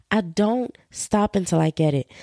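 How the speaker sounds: ordinary talk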